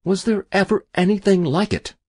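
high, delighted wonder; excited cry. A narrator's reading. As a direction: marvel aloud at the luck. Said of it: "cried Mister Castwell".

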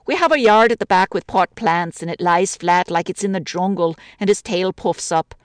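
lightly voiced Irish widow, chatty grumble; a lonely woman complaining about the city; a hint of an older woman's voice, gently aggrieved. Woman telling about her cat.

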